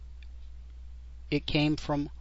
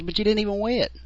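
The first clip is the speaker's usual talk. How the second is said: incredulous; 9 semitones higher, 3 dB louder, faster than usual. Retold or imitated. Apprentice protests to old butcher.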